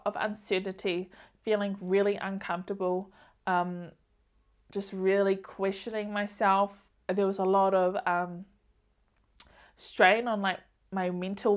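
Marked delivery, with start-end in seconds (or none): none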